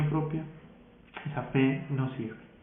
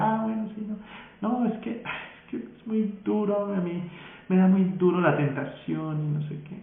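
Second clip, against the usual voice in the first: whiny excuse; 6 semitones higher than usual. Retold or imitated. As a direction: mimic a weak believer whining. Imitating tempted believer's whining. (voz quejumbrosa y aguda de creyente débil)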